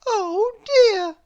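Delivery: very high, loud, crying oh dear; wailing princess in dismay; princess wails. Acted. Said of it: "said Little Miss Princess".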